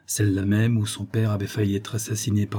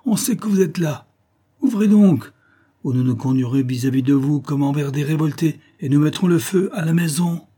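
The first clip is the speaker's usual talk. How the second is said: high, forceful menacing shout; rough threat; 6 semitones higher, 5 dB louder than usual. A narrator's reading. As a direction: threaten those behind the door. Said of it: "dit la même voix".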